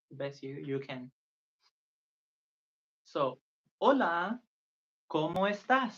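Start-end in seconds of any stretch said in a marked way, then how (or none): 3.0-5.9 hola rising, slow and loud, then ¿cómo estás? high; slow clear teacher demo; slow model phrase. Shown as a demonstration. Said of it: "Means, hello, how are you?".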